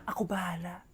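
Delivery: low scheming whisper. Acted. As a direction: breathe it secretly. Secret plotting whisper.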